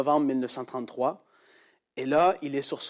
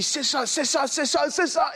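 excited realization; 11 semitones higher than usual. Retold or imitated. Boy discovers the verse.

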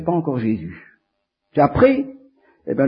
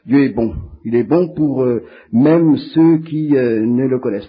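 proclaiming; 3 semitones lower, 7 dB louder than usual. Real, not acted. Preacher proclaims God is good.

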